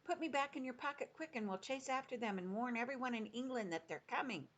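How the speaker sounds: put-on a little girl begging to give chase; Sophie, urgent girl. A narrator's reading.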